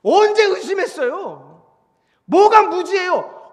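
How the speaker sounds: loud, high, drawn-out objection; wronged man protesting at full volume; indignant protest. Acted. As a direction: cry out against an unfair accusation. Protesting on wronged Job's behalf.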